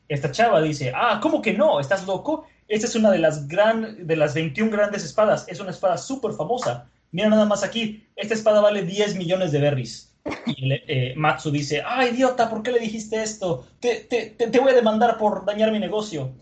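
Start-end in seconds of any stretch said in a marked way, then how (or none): 0.9-10.1 higher, incredulous insistence; indignant enthusiasm. Retold or imitated. Swordswoman correcting the customer about a famous sword, indignant. 11.8-16.3 high, stammering mock fury; exasperated scolding. Vendor berating the swordswoman for ruining his deal, furious.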